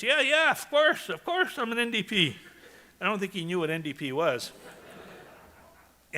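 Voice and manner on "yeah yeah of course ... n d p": excited barber. Retold or imitated. Barber assuring Leo of his politics, very animated. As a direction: imitate the animated barber eagerly agreeing. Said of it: "very excited".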